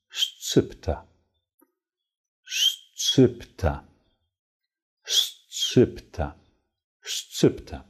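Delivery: "szczypta" with hissed sh-ch cluster; Polish model word. Shown as a demonstration. Teacher modelling Polish word for pinch. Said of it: "Eine Prise heißt auf Polnisch".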